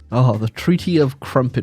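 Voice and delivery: ordinary delivery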